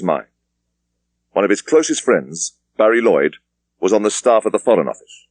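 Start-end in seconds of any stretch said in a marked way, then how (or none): none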